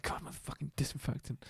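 unvoiced, hushed grumble; whispering. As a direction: whisper it under your breath. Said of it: "he'll be like".

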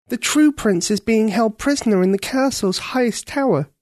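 put-on high, earnest young girl; a girl pleading to save the prince. A narrator's reading. Ketcha voiced in story.

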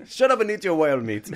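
hammed-up gruff dad snapping at the kid; mock stern papa. Father snapping at hungry child over dinner. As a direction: play a fed-up father, loudly.

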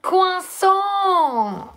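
high, stretched cartoon "kwa-SAW" sliding down; exaggerated cartoon French cry; Mickey Shorts guy. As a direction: sing out croissant high and long, like a cartoon. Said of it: "That's how he says it in Mickey Shorts".

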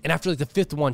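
usual speaking voice

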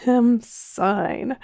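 high sigh at the end of a laughing fit; weak with hilarity. A narrator's reading. Mister Ee to the scowling men, weak with hilarity. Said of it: "sighed Mister Ee, weak with hilarity".

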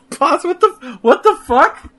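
high, laughing what the fuck; laughing disbelief. Real, not acted. Laughing disbelief at Pasta Mania.

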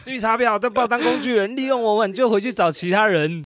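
high, loud mock outrage; mock angry rant. As the jilted rebound guy, a hammed-up angry rant.

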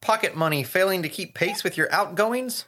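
playful advert voice; mock ad read. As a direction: read the sign like a pushy advert. Reads the twins' ad sign.

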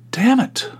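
high-pitched comic cuss; exasperated damn. Retold or imitated. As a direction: squeak it out in comic frustration. Retold frustration at missing sauce.

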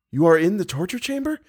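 high-pitched shocked question with rising end; alarmed disbelief. A narrator's reading. Captive learns where rescuers are.

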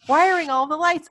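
high-pitched, lilting fond reminiscence; nostalgic Jon. Retold or imitated. Acting Jon reminiscing to Garfield.

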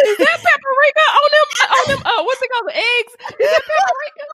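high, loud, laughing shout of praise; laughing mock hype. Mocking praise for deviled eggs, laughing high shout. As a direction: gush through laughter over the eggs. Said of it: "I'm about to throw the mic".

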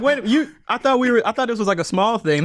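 high, confused protest; flustered surprise. Retold or imitated. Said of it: "I'm like".

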